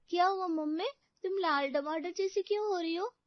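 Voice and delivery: put-on high-pitched young girl voice; puzzled child asking her mother, high; little girl's questioning tone; daughter's voice. A narrator's reading.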